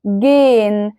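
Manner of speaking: "gehen" as a long GEHN with no h; silent h. Shown as a demonstration. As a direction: draw the e of "gehen" straight into n. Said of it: "نطق الها كده واضحة وصريحة؟". ("gehen" الهاء ساكتة والـ e ممدودة)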